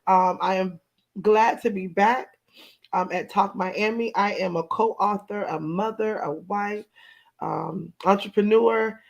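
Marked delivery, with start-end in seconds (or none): none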